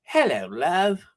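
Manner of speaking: put-on cheery hello in playful East End accent. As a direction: put on your best London East End. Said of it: "That's my best East End accent".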